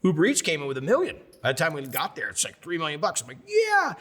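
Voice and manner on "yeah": high, loud triumphant yeah; elated cheer. Retold or imitated. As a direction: cry out in celebration. Celebrating the money raised.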